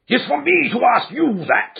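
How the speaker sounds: high, loud shriek. A narrator's reading. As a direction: shriek it back in rage. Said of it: "he shrieked".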